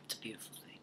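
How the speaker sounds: hushed breathy whisper. Real, not acted. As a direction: whisper it softly. Whispered aside, unvoiced.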